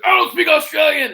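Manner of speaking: yelling at the guy who walked up; Macho Man shout. Retold or imitated. Loud high spitting shout. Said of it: "I spit when I talked".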